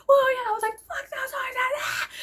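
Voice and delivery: high, loud laughing yell; screaming. Retold or imitated. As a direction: yell while driving past, cracking up. Screaming as they pass the line.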